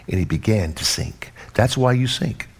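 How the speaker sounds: usual speaking voice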